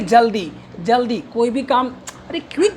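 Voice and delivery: ordinary talk, nothing marked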